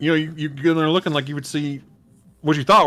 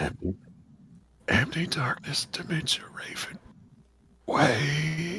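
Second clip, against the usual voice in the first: whispered deathbed words; dying last words; 5 semitones higher, 9 dB quieter than usual. Acted. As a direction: barely get it out, as if dying. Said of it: "do you have any last words".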